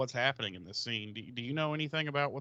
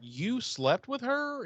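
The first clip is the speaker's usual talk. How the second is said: higher, slow shocked question; shocked Willow; 9 semitones higher, slower than usual. Retold or imitated. Willow asking Oz, slow and high, in shock. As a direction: gasp it out in disbelief. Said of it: "Willow's like".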